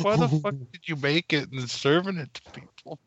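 high, incredulous mock complaint; retold disgust. Son questioning mom serving cream corn, acted disgust. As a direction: act out appalled disbelief. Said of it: "this all disgusted look".

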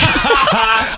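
high burst of laughter; laughing. Real, not acted. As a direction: laugh in surprise. Laughs at mom arriving.

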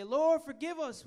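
high, loud, slow plea; interceding in prayer. Shown as a demonstration. Preacher showing the congregation how to intercede, pleading aloud. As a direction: call out to God for forgiveness. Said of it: "Somebody intercede".

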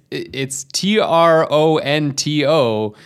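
T R O N T O spelled slowly letter by letter; spelling it out. Shown as a demonstration. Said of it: "you don't pronounce any of the T's".

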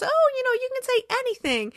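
high-pitched, breezy reassurance; upbeat coaxing. Retold or imitated. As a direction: act out a chirpy publicist. Voicing publicist coaching the players.

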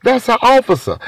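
high, incredulous protest; shooting her down. Retold or imitated. To his girlfriend, dismissing her ghost idea, high and incredulous. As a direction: brush off the idea, voice jumping up high. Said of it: "I shot it down immediately".

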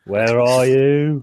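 slow, loud mock Australian accent; imitated Australian accent. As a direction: draw it out in a put-on Australian accent. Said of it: "I just did an accent".

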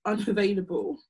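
ordinary talk, nothing marked

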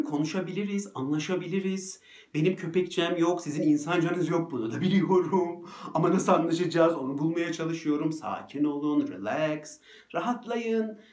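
clowning coax. Retold or imitated. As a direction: clowns around to charm the dogs, grinning. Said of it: "şaklabanlık yapıyorum".

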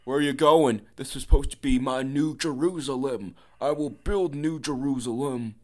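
mock indignant zealot. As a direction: proclaim it like a thwarted crusader. Acting out the abandoned zealot.

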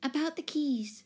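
high-pitched, earnest lady tenant. A narrator's reading. Timid tenant explaining to the new owner, earnest. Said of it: "she went on earnestly".

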